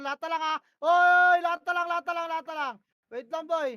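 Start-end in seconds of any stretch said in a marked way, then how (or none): 0.8-2.5 sudden loud yell, much higher; shout. Real, not acted. Shotcaller yelling to stop his team mid-fight, loud shout. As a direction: bark it to grab everyone's attention.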